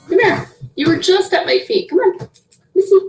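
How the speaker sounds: high coaxing pet voice; coaxing a cat. Real, not acted. Calling her sister's cat to her feet, coaxing and high. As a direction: sweet-talk the cat over.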